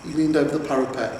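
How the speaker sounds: usual speaking voice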